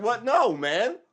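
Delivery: high, loud disbelieving protest; mock outraged refusal. People refusing the Soviet ways, mock outraged protest. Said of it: "They're gonna be like".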